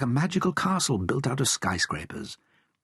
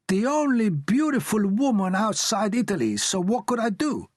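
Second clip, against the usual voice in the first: high, bright-eyed Italian father charming his listener; Italian dad voice; 11 semitones higher than usual. A narrator's reading. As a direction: play the romantic Italian dad, pitched up.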